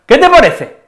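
"¿Qué te parece?" con subida aguda en qué y caída en parece; entonación animada. Shown as a demonstration. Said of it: "Con esta entonación". (entonación de ánimo muy aguda)

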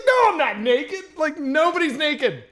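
loud, high-pitched retold protest; incredulous denial. Friend in the pool denies being naked, incredulous and loud.

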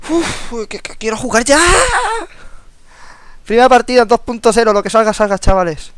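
can't wait another second, let it out; loud excited cry to the viewers as the first match begins; hyped up. Real, not acted. Game finally starting, hyped.

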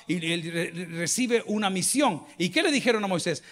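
ordinary talk

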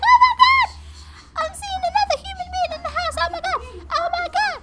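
very high, loud squeal; Roxy the dog. Acted. Player voicing the dog seeing a human, frantic squealing. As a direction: shriek like a dog frantic at a visitor. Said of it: "Roxy's gonna bark so loud right now".

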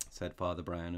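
ordinary delivery

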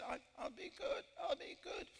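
high-pitched pleading parrot voice; squeaky little bird begging to be let off. Retold or imitated. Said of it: "And the parrot says".

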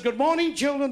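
high-pitched teacher's greeting; schoolteacher. Acted. Teacher greeting the class, high put-on voice. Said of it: "Teacher in school says".

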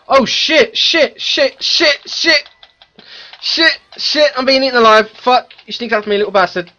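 high panicked screaming at the game; raging gamer. Real, not acted. Gamer attacked by monsters, panicking.

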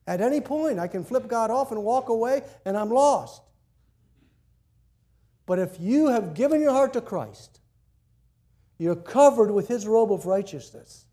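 loud, high pulpit preaching; full-force preaching. Acted. Pastor preaching loudly to the congregation about sin. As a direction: preach it out to the whole room.

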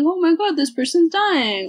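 high-pitched acted alarm; mock panic. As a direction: cry out like a panicking bystander, half in earnest. Imagined bystander raising the alarm.

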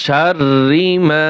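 শাররি মা slow, with a doubled র and a long final vowel; slow recitation. Shown as a demonstration. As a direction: stretch মা by one alif. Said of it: "এক আলিফ টান হবে". (ধীরে টেনে তেলাওয়াত)

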